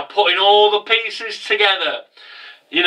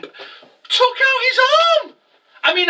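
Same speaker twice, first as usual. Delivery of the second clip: aghast. Real, not acted. Fan recounting the arm being taken, aghast. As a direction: stretch it into an outraged squeal. Shrieked disbelief at the twist.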